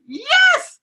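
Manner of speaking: a very high, loud yes breaking into a high laugh; excited cheer. Real, not acted. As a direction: celebrate the plan with a whoop. Cheering the vegan plan.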